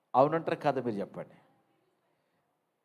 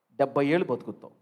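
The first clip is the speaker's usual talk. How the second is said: ordinary talk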